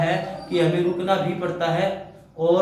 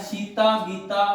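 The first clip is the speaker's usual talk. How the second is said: slow "Sita", then "Gita", each on its own; names set apart; slower than usual. Shown as a demonstration. Teacher placing a comma between names, slowly separating "Sita" and "Gita". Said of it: "Sita Gita को अलग करने के लिए".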